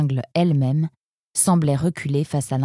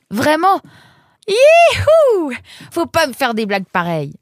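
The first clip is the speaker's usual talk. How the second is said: relieved cheer; 11 semitones higher, 7 dB louder than usual. Acted.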